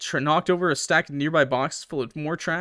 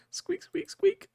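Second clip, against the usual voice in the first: much higher, tiny squeaky mouse voice; mouse squeaks; 4 dB quieter, slower than usual. Acted. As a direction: squeak like a frightened little mouse. Voicing the struggling mouse.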